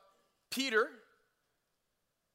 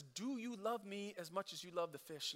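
gentle question; 11 dB quieter than usual. Retold or imitated. Jesus quietly asking Peter aside, soft and tender. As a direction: ask it like a quiet confession.

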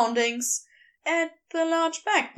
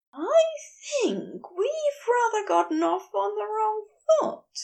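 higher, heated fussy angel voice; Aziraphale, wild; 9 semitones higher than usual. A narrator's reading. Angel ranting at the geologist, high and heated. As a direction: raise the pitch and let him rant.

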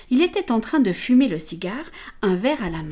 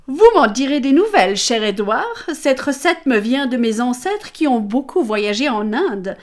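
louder, higher, sweetly persuasive; charming hostess; 3 semitones higher, 7 dB louder than usual. Acted. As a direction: sell him the punch with charm. Amelia offers punch to Callaghan. (hôtesse charmeuse, plus fort et plus aigu)

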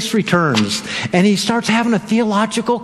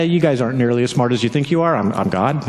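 low, dry Jesus voice; voicing Jesus; 8 semitones lower than usual. Jesus answering the accusers in a low voice. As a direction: say it low and knowing. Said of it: "And Jesus is like".

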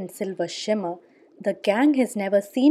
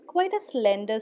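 ordinary delivery

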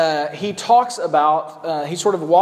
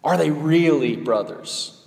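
slow pointed question; slower than usual. Shown as a demonstration. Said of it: "She wanted to know".

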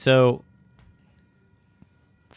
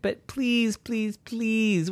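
high, insistent begging; persistent pleading; 11 semitones higher, 4 dB quieter, much slower than usual. Acted. Llama persisting with Zeus, pleading. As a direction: beg in a small high voice. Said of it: "persisted and said".

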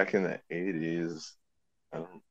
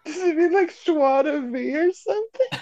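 high mock voice. Mock fancy French phrase.

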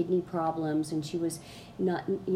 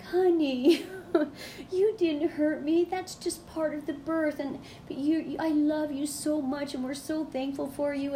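imitating a mother gushing, pitched much higher; a doting mother reassuring her daughter, much higher and tender; gushing mom; 9 semitones higher than usual. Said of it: "gushing like".

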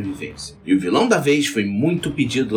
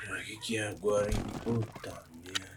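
groggy grumble; 3 semitones lower, 16 dB quieter than usual. Acted. Sheriff woken by radio call.

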